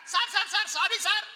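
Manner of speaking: high, loud hurried protest; urgent pleading. Acted. Student urgently interrupting the teacher, high pleading. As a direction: cut in desperately.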